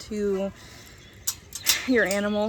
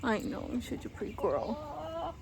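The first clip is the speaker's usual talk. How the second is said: softer, lightly put-on voice for the hen; pet voice for hen; 2 semitones higher, 6 dB quieter than usual. Real, not acted. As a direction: talk as if you were the chicken. Speaking for the chicken.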